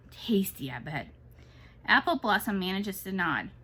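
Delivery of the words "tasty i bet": whispered. A narrator's reading. Amlet voiced in a read story.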